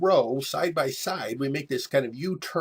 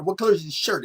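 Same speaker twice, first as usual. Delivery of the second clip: higher and louder, pushy interrogation; menacing bully question; 6 semitones higher, 6 dB louder, faster than usual. Retold or imitated. Boy on a bike grilling the kid, pushy and loud. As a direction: lean on the kid like a street tough.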